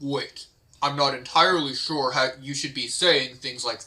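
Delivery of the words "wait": ordinary talk, nothing marked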